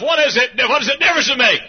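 high, loud demanding question; shouted challenge. Pretend. Preacher voicing a heckling congregation, loud and high. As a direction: shout the objection from the pews. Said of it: "you say for the house".

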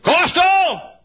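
put-on loud, high child's shout; child's yell. Boy shouting in the store, loud and high. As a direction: yell it like a lost kid. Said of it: "I said".

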